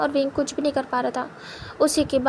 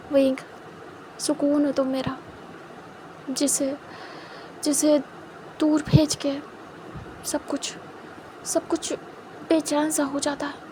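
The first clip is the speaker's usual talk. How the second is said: softer, half-whispered, halting; soft tender confession; 6 dB quieter than usual. Acted. Wang Ji hugging Wing, confessing how lost he is without him, softer and half-whispered. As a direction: pour out longing almost in a whisper.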